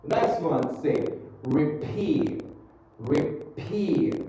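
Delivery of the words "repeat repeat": "Repeat" higher, with a hard puff on the P; stressed P. Shown as a demonstration. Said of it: "Ditekan, ya".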